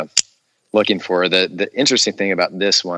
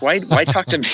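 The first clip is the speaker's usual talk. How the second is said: higher, incredulous question; disbelieving, much higher; retold disbelief; 9 semitones higher than usual. Actor retelling his surprise at being asked. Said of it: "I'm like".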